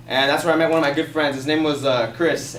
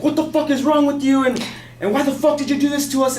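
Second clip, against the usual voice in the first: higher-pitched mock yell of an irate man; angry yelling neighbor; 10 semitones higher than usual. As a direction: imitate a man ranting from his apartment. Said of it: "yelling all these".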